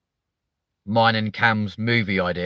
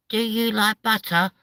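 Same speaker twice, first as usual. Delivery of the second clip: high sing-song playground taunt; mocking kid; 13 semitones higher than usual. A kid in the playground taunting with a buttercup, high. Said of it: "buttercup going".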